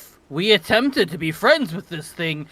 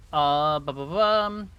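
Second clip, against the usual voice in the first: normal talking voice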